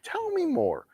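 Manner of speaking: high mock-eager coaxing. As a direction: coax him on in a high sweet voice. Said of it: "just like".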